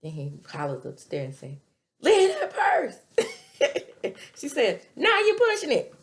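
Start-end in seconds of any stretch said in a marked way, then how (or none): 2.0-3.0 loud, high holler with a laugh; hollered upstairs. Retold or imitated. Father hollering upstairs to her, laughing. 3.0-4.3 breathy high laughing; high laughter. Real, not acted. Speaker cracking up at the scene. 5.0-5.9 high, loud comeback; loud mock retort. Woman yelling back down to him. She answers loudly.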